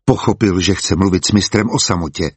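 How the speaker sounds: usual speaking voice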